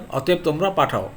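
ordinary talk

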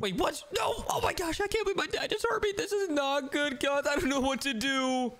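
high, frantic hide-and-seek alarm; play-acted high-pitched dismay; mock panic. Dad overheard the hiding child.

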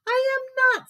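put-on high, insistent kid-tiger voice; indignant little tiger. A narrator's reading. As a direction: squeak back in defiance. Little Tiger protests to brother.

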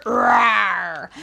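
a lighter playful roar; mild dinosaur roar. Acted. Host roars in the song for the children, lighter and playful. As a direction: give a mock roar, not too big. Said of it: "letting out a roar".

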